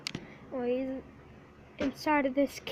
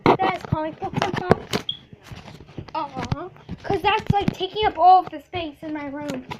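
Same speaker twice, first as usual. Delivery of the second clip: loud call across the house; 3 semitones higher, 8 dB louder than usual. Real, not acted. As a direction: call out to someone in another room. Calling to someone in another room.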